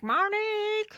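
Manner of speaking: high shrill call of her name; mock mother's high call; calling Monique. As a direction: yell for her from the doorway. Mrs Smith calling out.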